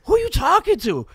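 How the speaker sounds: high, very loud disbelieving question; re-enacted yell at a buddy; incredulous retold yell. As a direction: shout it in mock bafflement. Acted baffled friends in retold story.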